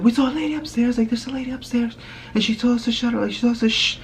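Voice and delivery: high-pitched frightened whine; imitated crying kids. Scared kids telling mom about the lady, high mock crying. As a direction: mimic scared children tattling in a squeal. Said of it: "So we're crying, like, we can't even talk".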